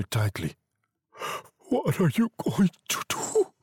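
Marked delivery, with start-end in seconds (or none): 1.7-3.5 frightened, pitch raised and broken; scared old man. A narrator's reading. Husband holding his wife back, scared voice. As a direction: ask it in dread, voice jumping high. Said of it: "he asked in a low, scared voice".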